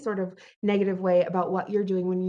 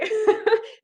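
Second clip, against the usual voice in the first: short bright giggle; high laugh; 12 semitones higher, 5 dB louder than usual. Real, not acted. After her coffee joke, a quick laugh.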